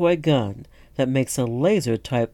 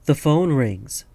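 ordinary delivery

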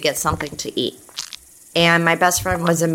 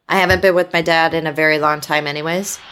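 normal talking voice

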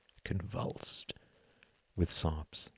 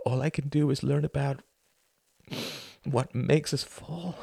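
higher, pained voice close to tears; pleading confession; 7 semitones higher than usual. Acted. A son telling his dying mother he knows. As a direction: confess it, voice straining upward.

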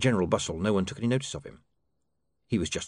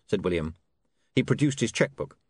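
ordinary delivery

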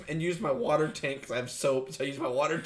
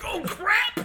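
high, louder surprised yelp; startled oh crap; 6 dB louder than usual. Retold or imitated. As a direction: blurt it as the mess sinks in. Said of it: "and I'm like".

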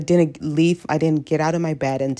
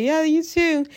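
much higher, sugary thank-you; mock sweet reply; 10 semitones higher, slower than usual. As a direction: play it overly nice. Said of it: "I was like".

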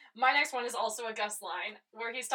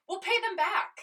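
quoting Maddie; 8 semitones higher, 4 dB louder than usual. Retold or imitated. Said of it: "And she says".

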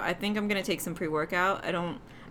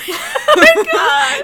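high shrieking laugh; laughing shout; 12 semitones higher, 12 dB louder, much slower than usual. Real, not acted.

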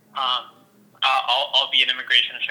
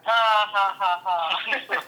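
laughing; 6 semitones higher, much slower than usual. Real, not acted. Laughing at bar saga.

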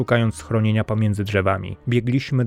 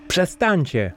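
higher, mild mock shout; 8 semitones higher, slower than usual. A narrator's reading. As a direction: narrator yells at the sailors to stop, mild shout. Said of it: "krzyczałem".

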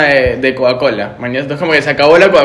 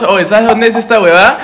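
laughing question; 5 semitones higher, 3 dB louder than usual. Retold or imitated. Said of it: "Y le preguntamos".

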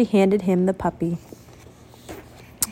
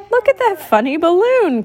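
put-on higher, excited child's voice; little boy calling; 8 semitones higher than usual. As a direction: point it out to the crowd. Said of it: "called a boy".

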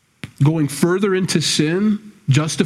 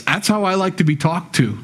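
ordinary talk, nothing marked

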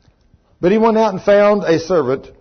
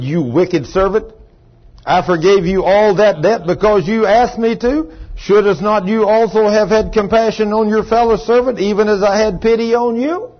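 higher, louder indignant reading; angry rebuke; 5 semitones higher, 4 dB louder than usual. Acted. Lord rebuking the servant he had forgiven, angry. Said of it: "And his lord was angry".

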